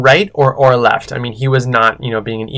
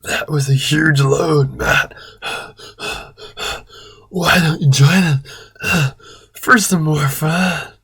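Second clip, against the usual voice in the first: hammed-up breathless panting; Chris; 2 semitones higher, 4 dB quieter than usual. A narrator's reading. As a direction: pant between phrases, nearly whispering. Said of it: "Chris said in a breathless voice".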